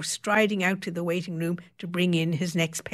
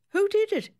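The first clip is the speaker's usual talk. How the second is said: sharp, much higher demand. A narrator's reading. Secretary demands to know the surgeon, sharp and high. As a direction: insist on a name right now. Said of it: "the other woman demanded".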